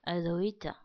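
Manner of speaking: ordinary talk, nothing marked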